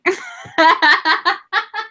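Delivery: a sudden high giggle breaking out; real laughter at the joke; high burst of laughter. Real, not acted.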